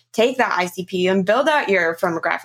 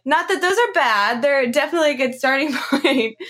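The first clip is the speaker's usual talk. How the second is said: higher, playful walk-back with a laugh; amused; 6 semitones higher than usual. Real, not acted. Host softening her comment to listeners, laughing. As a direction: laugh it off lightly.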